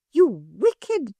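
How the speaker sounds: high, indignant scolding; furious woman scolding intruding children; angry cry. A narrator's reading. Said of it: "she cried".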